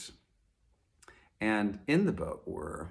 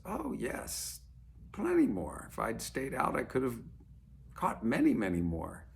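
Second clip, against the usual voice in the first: much higher, lilting fisherman voice; cheerful fisherman; 4 semitones higher than usual. Acted. Fisherman tells the businessman he could have caught more, higher and cheerful. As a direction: shrug it off brightly, plenty more where those came from. Fisherman boasts of his catch.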